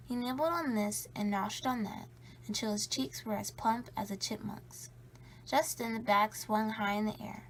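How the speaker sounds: plain reading voice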